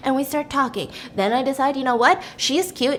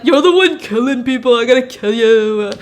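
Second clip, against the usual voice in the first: mock macho guys; 6 dB louder than usual. Mocking the hotheaded guys.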